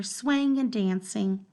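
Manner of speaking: ordinary delivery, nothing marked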